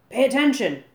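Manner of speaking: loud high scream of an order. A narrator's reading. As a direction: bark it at the whole beach. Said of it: "screamed Goober".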